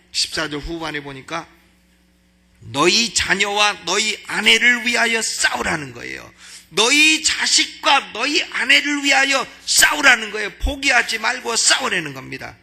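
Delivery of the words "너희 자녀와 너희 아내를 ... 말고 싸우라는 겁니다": rising sermon shout; preaching at full force. Acted. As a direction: thunder it out to the congregation. Preaching climax, loud.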